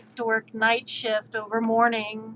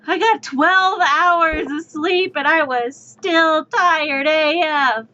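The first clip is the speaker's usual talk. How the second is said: exasperated; 5 semitones higher, 6 dB louder, slower than usual. Real, not acted. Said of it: "exasperated about sleep".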